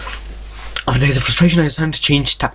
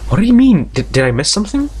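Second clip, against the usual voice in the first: baffled; 7 semitones higher than usual. Real, not acted. Baffled by a character's remark.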